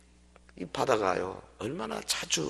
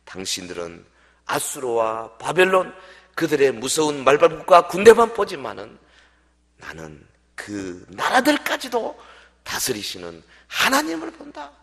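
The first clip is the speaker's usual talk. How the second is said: prophet proclaiming; 8 dB louder than usual. Retold or imitated. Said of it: "선지자가 외칩니다". (선지자의 힘찬 선포)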